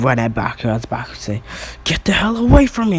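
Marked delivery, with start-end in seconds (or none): 1.8-3.0 high, raised shout; acted fighting yell, pitched way up; alarmed yell. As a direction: shout to drive an attacker off. Baku fends off attacking villain.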